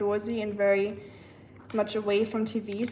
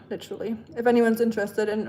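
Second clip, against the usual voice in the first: usual speaking voice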